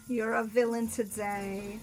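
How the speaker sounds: slow, drawn-out, at normal volume; slow repeat. Real, not acted. Repeating the villain charge to Luna, slowly drawn out. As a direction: stretch the words out as the volume settles.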